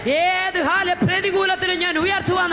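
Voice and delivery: fiery preaching. Real, not acted. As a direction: build each line like a rallying cry. Preaching at full force.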